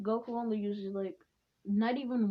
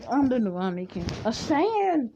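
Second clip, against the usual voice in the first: proclamation; 3 semitones higher, 8 dB louder than usual. Acted. Acting out the new king's proclamation.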